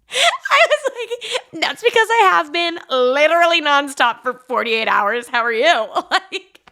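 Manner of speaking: giggling while retelling what she said; laughing. Real, not acted. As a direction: laugh the retort out at her own state. Laughing while retelling own reply.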